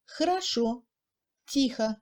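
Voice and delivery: "хорошо, тихо" with unstressed О as mute e; strongly reduced O. Shown as a demonstration. Teacher showing second-degree reduction, "хорошо, тихо" with faint О. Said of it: "Ce son ressemble aussi au E muet".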